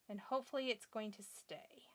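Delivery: hopeful little whisper. Real, not acted. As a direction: whisper it, fingers crossed. Hoping the glue holds.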